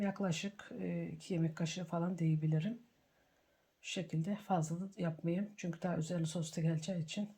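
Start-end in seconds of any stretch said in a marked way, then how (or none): none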